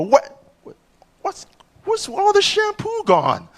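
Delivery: high-pitched mock girlfriend; puzzled girlfriend. Comic imitating his girlfriend finding the shampoo gone, puzzled. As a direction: squeal the question in bafflement.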